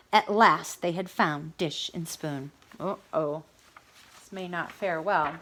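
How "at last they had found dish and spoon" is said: usual speaking voice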